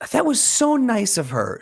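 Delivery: high-pitched imitation of an old lady; appreciative old lady. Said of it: "the old lady goes".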